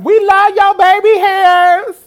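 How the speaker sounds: high, loud, drawn-out squeal; screaming fan. Retold or imitated. Fan yelling a compliment to singer signing autographs, shrieked adoration. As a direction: holler it slowly in falsetto over a crowd.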